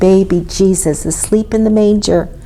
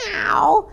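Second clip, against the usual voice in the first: cat yowl; 10 semitones higher than usual. A narrator's reading. Skinny cat chased by a dog, high meow. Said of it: "yowled Skinny".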